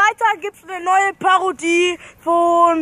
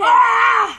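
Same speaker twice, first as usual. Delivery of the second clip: pained shout; 4 semitones higher, 5 dB louder than usual. Real, not acted. Reacts to spicy chili.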